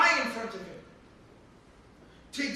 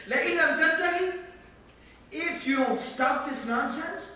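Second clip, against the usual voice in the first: rapid, raised threat; threatening; 5 semitones higher, 4 dB louder, faster than usual. Acted. Father threatening his son, rapid and raised. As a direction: spit out the ultimatum fast and hard. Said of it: "He threatens him".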